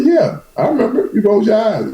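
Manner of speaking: high, lightly voiced old mother; a hint of an amused elderly mother; mother remembering. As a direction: recall it like a wry mama. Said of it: "She said".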